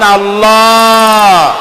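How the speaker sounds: আল্লাহ loud, last vowel rounded and held long; drawn-out rounded pronunciation. Shown as a demonstration. Preacher leading everyone to pronounce it, long rounded call. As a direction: swell on the rounded end of আল্লাহ. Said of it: "বলার সময় মুখটা গোল হয়ে যাবে".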